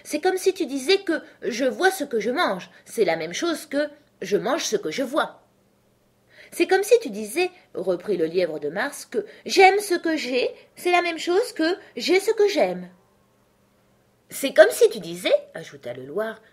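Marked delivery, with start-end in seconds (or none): 0.0-5.4 high, animated rebuttal. Acted. Hatter mocks Alice's logic, animated and high. 6.5-7.5 very high, loud character voice. Acted. March Hare joins in against Alice, high and loud. 9.1-12.9 very high, loud character voice. Acted. March Hare joins in against Alice, high and loud. 14.3-15.3 high, loud character voice. Acted. Dormouse joins the argument, high and loud.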